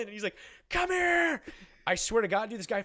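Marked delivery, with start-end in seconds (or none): 0.7-1.4 high, loud drawn-out bellow; imitated furious shout. Furious dad starting to chase the kid, imitated shout. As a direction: holler it like you're about to charge.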